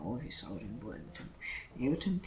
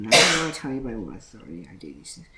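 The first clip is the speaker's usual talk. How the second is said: louder, firm and final refusal; 2 semitones lower, 12 dB louder than usual. Acted. Jesus to the chief priests, a loud firm refusal. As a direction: shut the question down with a raised voice.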